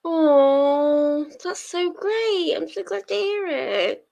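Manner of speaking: high, drawn-out cooing; delighted for them. Real, not acted. Streamer hearing a viewer's good news, high delighted coo. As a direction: gush slowly at the good news.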